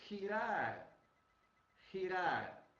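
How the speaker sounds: girar with a throaty Spanish start; Spanish girar, slowly. Shown as a demonstration. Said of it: "o verbo".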